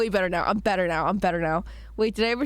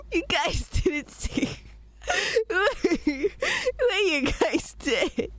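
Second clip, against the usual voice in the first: embarrassed laughing; 10 semitones higher, slower than usual. Real, not acted. Embarrassed she was heard unmuted.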